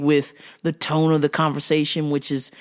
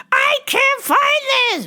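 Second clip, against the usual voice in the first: high, loud mock demand; hammed-up high shouted complaint; shouting customer; 4 dB louder than usual. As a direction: yell it like a pushy customer.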